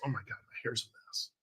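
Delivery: fast whisper. Real, not acted. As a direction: whisper it quickly to yourself. Whispered aside about hair.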